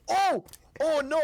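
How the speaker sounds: big worried cry of oh no; alarmed giant. Acted. Bork crying out after knocking the bard over. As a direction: gasp it out as the giant.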